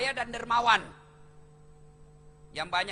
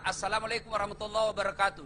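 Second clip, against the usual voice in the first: rushed salam; faster than usual. Shown as a demonstration. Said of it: "Jangan, bos".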